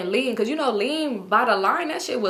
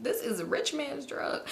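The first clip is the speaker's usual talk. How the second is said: soft, slow mock indignation; offended; 3 semitones higher, 11 dB quieter, slower than usual. Man defending his drug, quietly offended imitation. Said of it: "getting real offended".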